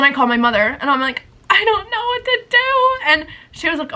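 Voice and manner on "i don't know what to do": high, drawn-out helpless whine; exaggerated wailing plea to mom; mock wail. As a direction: whine it slowly, hammed-up despair. Retold distraught call to mother.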